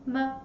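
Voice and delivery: "M" as a very short, quick "ma"; "M" let go at once, a toneless little "ma"; light tone. Shown as a demonstration. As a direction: say "M" as a light, brief "ma" syllable. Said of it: "Very light and quick".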